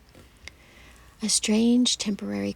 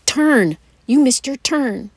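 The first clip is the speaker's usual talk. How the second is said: much louder, higher panicked yell; alarmed shout; 5 semitones higher, 13 dB louder than usual. Acted. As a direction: shout at the driver as the turn goes by. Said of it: "And then she gasps".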